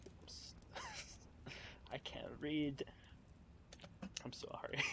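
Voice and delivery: whispered apology, half laughing; embarrassed whisper. Real, not acted. Reader whispering an apology for misreading. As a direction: hush it like a giggly confession. Stumbles over the text, embarrassed aside.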